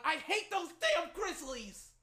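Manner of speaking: high, drawn-out exasperated cry; real angry outburst. Real, not acted. Fan curses the rival team mid-game, high angry outburst. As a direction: vent hatred at the rivals, slowly.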